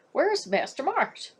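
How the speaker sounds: put-on a young boy asking urgently; boy's voice. Boy anxiously asks the guard where Marsh went. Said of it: "Forrest asked".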